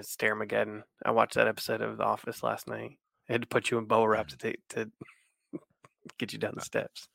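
ordinary talk, nothing marked

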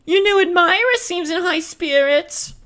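very high falsetto; high-pitched comic character; Marie's squeaky voice. Acted. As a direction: play it in a put-on squeak. Marie teases Dora in script.

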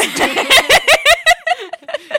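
a big real laugh at your own joke; loud high laughter. Real, not acted.

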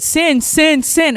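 high, loud repeated cry of a name; urgent calling out. Retold or imitated. Child crying out a name after an accident, urgent and high. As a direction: imitate a frantic call for help. Boy's frantic cry acted out.

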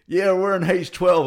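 loud, high and drawn-out announcement. Retold or imitated. Loud cinemagoer announces the group's seats, very loud. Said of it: "very loud".

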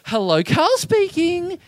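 high, slow, put-on phone voice; deliberate joke greeting. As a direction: answer very deliberately for laughs. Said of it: "very deliberately in front of people".

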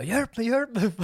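high, loud cry for help; mock panicked plea. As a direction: imitate someone frantically begging for help. Panicked man runs in, retold.

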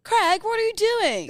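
mock American call. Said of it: "the way Americans pronounce the name Craig".

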